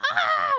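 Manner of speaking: high startled scream; startled yelp. Retold or imitated. Caught twin at the clock tower, high startled scream. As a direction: yelp like you've been caught.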